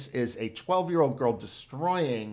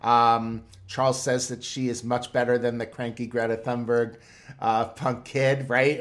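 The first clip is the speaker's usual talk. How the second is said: usual speaking voice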